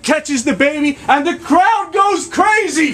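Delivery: high, slow, shouted narration; big climactic shout. Acted. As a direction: shout out the punchline. Climax of the crowd scene.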